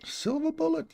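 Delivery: high mocking chat echo.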